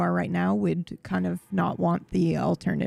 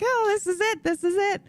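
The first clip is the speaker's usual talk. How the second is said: much higher giddy "this is it"; thrilled; 11 semitones higher than usual. Retold or imitated. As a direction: squeal it like the big moment has come. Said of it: "I'm like".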